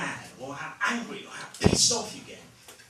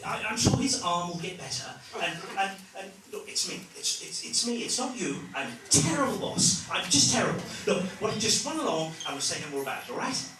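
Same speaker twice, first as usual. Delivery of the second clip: higher, flustered apologetic boss; awkward English boss; 5 semitones higher, 3 dB louder than usual. Retold or imitated. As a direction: dither and fumble like a nervous Brit boss. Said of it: "With us, it's like".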